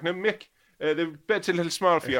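high, loud Irish landlady voice; landlady's voice. Retold or imitated. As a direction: bark it out like a brusque old landlady. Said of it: "she was like".

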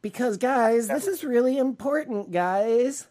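high, preachy mock urgency to the viewers; mock earnest plea. Co-host mocks the politician.

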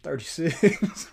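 drawn-out mock quote breaking into laughter; laughing imitation. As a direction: imitate the kid while cracking up. Mocking the kid's line through laughter.

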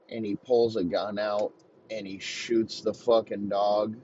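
normal talking voice

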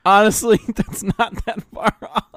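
loud, high words through laughter; laughing agreement. Real, not acted. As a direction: agree while cracking up. Laughing at the simp joke.

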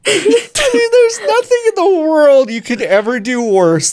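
laughing outburst. Real, not acted. Host laughing hard at Natalie's accent. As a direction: crack up while roasting her.

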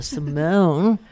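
"Simone" with the weight on its second syllable; accent on Simone. Shown as a demonstration. Founder showing how she said her boyfriend's name, Simone accented. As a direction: stretch and stress the "mone" in "Simone". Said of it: "with an accent on the".

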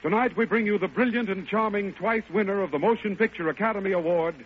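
ordinary talk, nothing marked

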